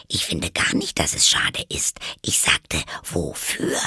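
voiceless, hushed clarification; whispered correction. Acted. Alice corrects the Rabbit, whispering. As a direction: correct them under your breath.

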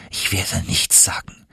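whispered vow to himself. Acted. Nephew swearing silence to himself, whispering. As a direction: breathe it out, barely voiced.

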